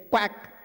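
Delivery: playful imitated duck call; duck quack. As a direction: mimic a duck's quack to greet it. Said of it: "but then he said".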